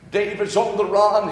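usual speaking voice

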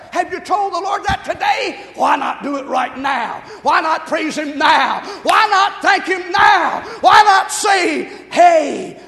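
loud, driving pulpit challenge; full-force preaching. Real, not acted. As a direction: hammer each question out at the congregation.

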